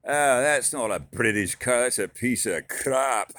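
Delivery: put-on hamming up a Scotsman's British accent. Walmart guy greeting him, in a hammed-up British accent. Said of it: "British, Scottish".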